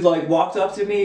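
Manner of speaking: ordinary talk, nothing marked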